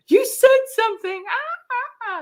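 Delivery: mock-shocked crowd reaction; amazed exclamation. People reacting to her video, high and amazed.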